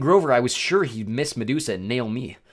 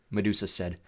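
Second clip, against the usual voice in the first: ordinary delivery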